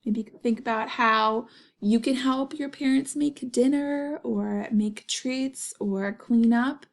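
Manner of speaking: ordinary delivery, nothing marked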